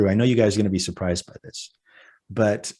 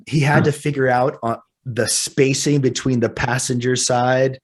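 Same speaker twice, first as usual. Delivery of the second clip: ordinary delivery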